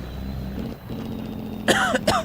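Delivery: rough hacking cough after a siren impression; real painful coughing; harsh coughing fit. Real, not acted. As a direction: cough hard, throat scraped raw. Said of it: "That hurt my throat".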